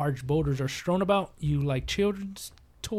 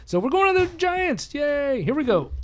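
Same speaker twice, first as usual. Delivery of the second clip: excited cheer; 10 semitones higher, 3 dB louder than usual. Real, not acted. Cheering for the adventure.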